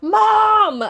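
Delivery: very high, loud yell; screaming. Retold or imitated. Screaming at mom who isn't waking up, acted scream. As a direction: scream for mom at full pitch, retelling it. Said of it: "I'm screaming at her".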